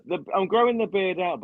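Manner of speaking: excited. Real, not acted. Joking about beard.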